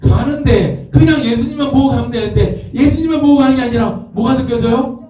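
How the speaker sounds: high, urgent preacher telling the story; high-pitched sermon storytelling; impassioned preaching. Acted. Raised preaching voice.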